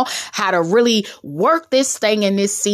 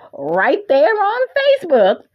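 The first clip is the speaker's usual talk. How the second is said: high, playful sing-out; comically raised pitch on the whole phrase; playful high repeat; 7 semitones higher than usual. Pretend. Playful repeat after laughing.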